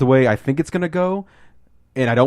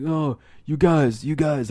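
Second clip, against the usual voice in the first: higher, drawn-out tipsy affection; lightly slurred, slow mock-drunk fondness; drunk bonding; 4 semitones higher, slower than usual. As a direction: imitate a sentimental drunk. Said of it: "they're all bonding over being drunk".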